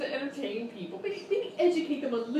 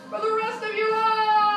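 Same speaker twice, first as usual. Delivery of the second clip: higher, louder shouted rant; ranting climax; 8 semitones higher, 6 dB louder than usual. Acted. Host's tirade at contestants, shouted climax. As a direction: top off the tirade with a yell.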